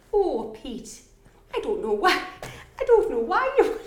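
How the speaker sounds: high, put-on Teesside mum voice; Teesside mum accent. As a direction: imitate a Teesside mum talking to Pete. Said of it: "I try and be your mum".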